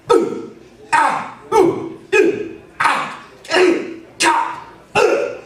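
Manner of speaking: high, sharp grunts of being punched; believable punch grunts. Shown as a demonstration. Teacher dropping onto heels to fake punches, believable impact grunts. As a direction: grunt as if a blow jolts up through the lungs. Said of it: "Listen to the difference here".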